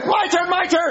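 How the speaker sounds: high yell demanding his turn; shouting. Acted. Kid yelling at Chef Pee Pee about his turn. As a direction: shout it like a kid who was skipped.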